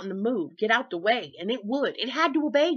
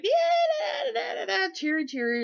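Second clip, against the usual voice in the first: very high, shouted singsong cheer; over-cheery; 14 semitones higher than usual. Retold or imitated. Imitating her younger self, shrill and overly cheery at dawn. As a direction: bounce it out like an over-excited kid. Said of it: "I was always like".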